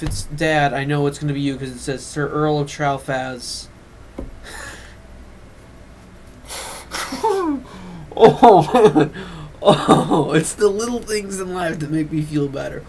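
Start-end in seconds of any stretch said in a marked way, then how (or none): 3.9-8.1 high breathy laugh. Real, not acted. Laughing at dad's silly account name, high breathy laugh. 8.2-12.8 laughing. Real, not acted. Recovering from laughter.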